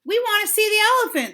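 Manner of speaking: loud high yell; rowdy kids shouting. A narrator's reading. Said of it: "they yelled".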